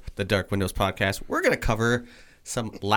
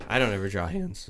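voice cracking; 4 dB quieter, faster than usual. Real, not acted. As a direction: voice cracks mid-line. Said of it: "My voice just cracked right there".

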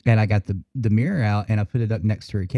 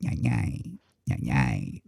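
soft, lower murmured night night; under her breath; 11 semitones lower, 7 dB quieter, slower than usual. Retold or imitated. Imitating the bird saying goodnight to mom, softly. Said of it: "under her breath".

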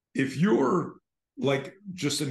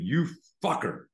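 a higher-pitched yelled insult at the machine; mock screaming; 6 semitones higher than usual. Shown as a demonstration. At the ATM, pretending rage for a lurker: screaming. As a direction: scream the curse as if furious. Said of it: "you start screaming".

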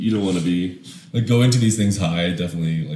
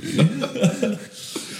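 high laugh; 13 semitones higher, 4 dB quieter than usual. Real, not acted. Laughs at own joke.

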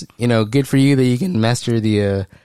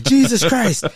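high, laughing gasp of disbelief; mock awe, pitched way up; stunned exclamation; 14 semitones higher, slower than usual. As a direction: blurt it out, floored and laughing. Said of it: "And I'm like".

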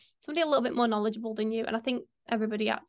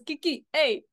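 imitating Daniel; 5 semitones higher than usual. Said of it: "You sound like Daniel when he goes".

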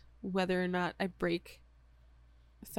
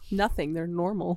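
ordinary delivery

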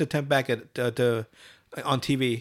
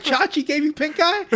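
high, loud disbelief; incredulous; 11 semitones higher, 3 dB louder than usual. Retold or imitated. Reacting to Cartman's pink eye story, high disbelief. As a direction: gasp the question in disbelief.

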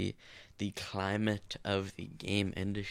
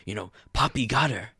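imitating a YouTuber; 3 semitones higher, 4 dB louder than usual. Retelling a YouTuber's staged tragedy, mock alarm. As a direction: play up the fake drama, punching the middle. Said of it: "that he was like".

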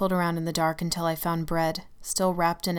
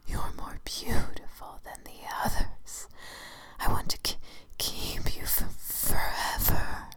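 tearful whisper; slower than usual. Acted. The shadow's possessive confession.

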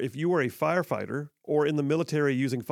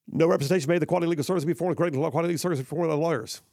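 garbled, breakneck legal disclaimer; rushed disclaimer; 2 semitones higher, much faster than usual. Real, not acted. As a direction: rattle off the legal fine print. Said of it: "that thing I gotta do".

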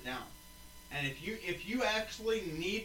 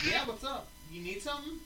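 higher, easy phone greeting; casual phone answer; 5 semitones higher, 4 dB quieter than usual. Retold or imitated. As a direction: lightly act out picking up a call. Acting out call with dad.

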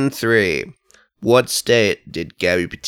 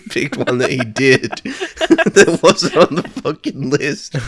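laughing; 8 semitones higher, slower than usual. Real, not acted. Host cracks up.

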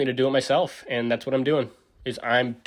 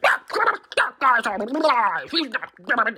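mocking moms; 11 semitones higher, 6 dB louder, slower than usual. Moms voiced arguing with him.

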